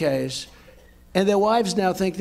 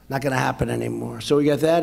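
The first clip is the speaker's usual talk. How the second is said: usual speaking voice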